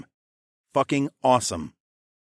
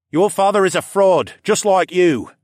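higher, louder angry retort; 9 semitones higher, 8 dB louder than usual. Acted. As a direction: throw the insult right back. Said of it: "Callan retorted".

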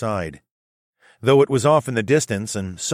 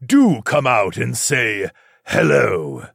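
slow, loud, booming demon king; a giant demon calling out, slow; booming demon lord; 2 semitones higher, 4 dB louder, slower than usual. Acted. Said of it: "the Demon King's booming voice asked".

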